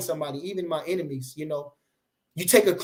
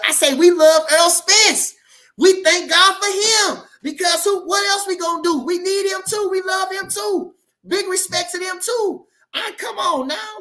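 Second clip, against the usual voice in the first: high, loud shouted declaration; retold loud shout; 12 semitones higher, 7 dB louder than usual. Boxer hyping his rival to Bud, loud high shout. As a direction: belt it out like a hype man. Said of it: "like I told Bud".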